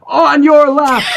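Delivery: high, sharper mock yell; impatient call. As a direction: bark the warning, fed up. Cyclist's third impatient call.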